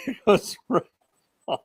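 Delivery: ordinary delivery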